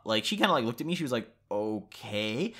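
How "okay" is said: soft, drawn-out okay with a questioning rise; taken aback. Retold or imitated. Date hears he must leave, softly puzzled. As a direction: imitate her hesitant, unsure reply. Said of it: "froze up".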